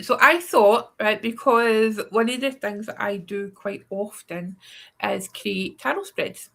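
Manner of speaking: ordinary delivery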